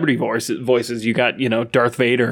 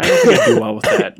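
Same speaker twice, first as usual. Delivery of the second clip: squeaky, fast laughing protest; laughing high disbelief; 5 dB louder than usual. Real, not acted. Friend imagining Gordon Ramsay yelling at him, laughing high protest.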